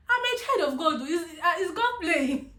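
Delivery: high, loud cry of disbelief; reliving an outraged outburst; upset protest. Retold or imitated. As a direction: protest to the heavens, half laughing. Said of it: "I'm upset that I'm in this situation".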